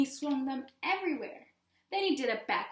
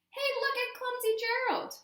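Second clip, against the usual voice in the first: high, loud mocking jeer; sneering animals mocking Gerald; 13 semitones higher, 5 dB louder than usual. A narrator's reading. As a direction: taunt him like a playground bully. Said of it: "the animals all sneered".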